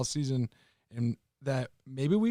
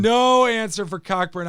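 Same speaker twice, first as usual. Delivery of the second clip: higher, louder gleeful repeat; amused loud echo; 6 semitones higher, 7 dB louder than usual. Acted. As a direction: echo the punchline with glee. Cohost echoes joke loudly.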